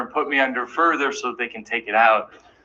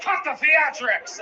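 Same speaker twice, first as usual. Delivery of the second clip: stern father; 10 semitones higher, slower than usual. Retold or imitated. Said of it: "he got mad at me".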